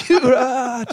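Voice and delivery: high, loud comic cry through laughter; mock outraged yell. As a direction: imitate a furious man yelling down the street. Imitates angry man yelling down street.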